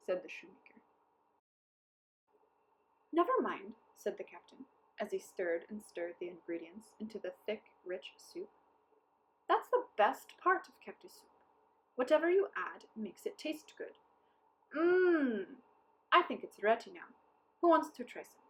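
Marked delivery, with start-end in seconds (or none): 3.1-3.8 lightly voiced captain. A narrator's reading. 9.5-18.4 higher, louder crafty captain; hearty captain, coaxing. A narrator's reading. Captain voiced.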